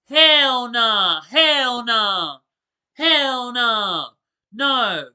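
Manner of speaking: emphatic refusal. Real, not acted. Reacting to the scary story.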